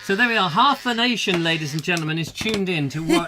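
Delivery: loud, high showman announcing; announcer voice. Pretend. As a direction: boom it out like a TV host. Hosting a mock live show.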